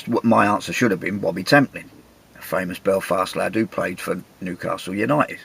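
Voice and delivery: ordinary talk, nothing marked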